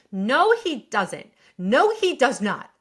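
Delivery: slow, loud and firm, punching the first "No"; emphatic no. Real, not acted. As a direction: shut it down, one word at a time. Host rejects Carrie's plea.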